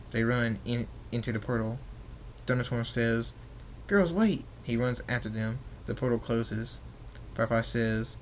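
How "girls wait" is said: calling out. Acted. Narrator voices father calling after.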